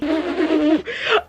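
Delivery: rising embarrassed laugh. Real, not acted. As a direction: laugh at yourself. Laughing at crooked cut.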